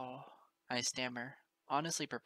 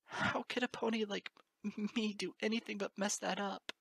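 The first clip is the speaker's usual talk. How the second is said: higher, crying voice, halting; tearful stammer; 6 semitones higher than usual. Acted. Moon Dancer confessing fears to Lyra, tearful. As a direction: hiccup through the fear.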